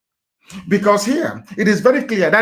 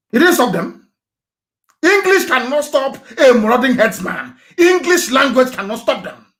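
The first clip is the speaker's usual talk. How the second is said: louder and higher, railing at them; angry outburst; 7 semitones higher, 4 dB louder than usual. Real, not acted. Activist to critics who speak grammar, angry outburst. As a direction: hammer home that English is useless. Heated rant about English.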